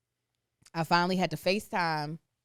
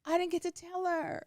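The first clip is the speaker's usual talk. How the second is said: high whine; 10 semitones higher, 3 dB quieter than usual. Retold or imitated. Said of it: "And I was like".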